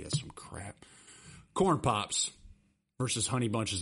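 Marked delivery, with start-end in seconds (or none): 0.0-0.8 soft unvoiced grumble under the breath; grumbling quietly after mom's cereal verdict; whispered aside. Real, not acted. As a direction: mutter it to yourself.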